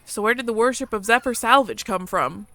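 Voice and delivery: ordinary delivery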